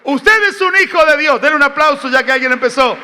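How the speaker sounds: loud, rising sermon delivery; preaching at full force. Acted. Pastor preaching loudly to his congregation, full force.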